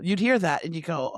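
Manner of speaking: ordinary talk, nothing marked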